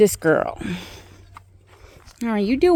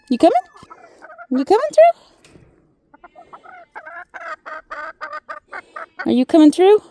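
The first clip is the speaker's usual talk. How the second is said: coaxing call; much faster than usual. Real, not acted. Coaxing goat through gate.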